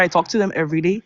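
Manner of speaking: usual speaking voice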